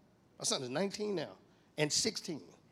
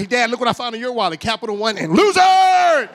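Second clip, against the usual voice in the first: gloating taunt; 6 semitones higher, 15 dB louder than usual. Retold or imitated. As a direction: rub it in like a bragging kid. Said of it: "He's running my room like".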